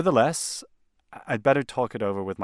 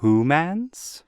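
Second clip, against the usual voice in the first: incredulous; 5 semitones higher, 5 dB louder than usual. Acted. Client hears of humans.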